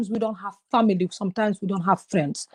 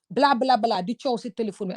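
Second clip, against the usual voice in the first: ordinary talk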